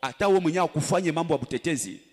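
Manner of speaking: ordinary talk, nothing marked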